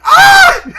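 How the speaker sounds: shrieking laughing squeal, very high and loud; inner scream of joy. Retold or imitated. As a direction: let out a gleeful inner shriek. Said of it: "Meanwhile, on the inside, I'm like".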